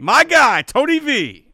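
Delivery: loud, high, hyped-up yell; excited shout. Real, not acted. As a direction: shout his name like a fan. Hyped shout about a rival.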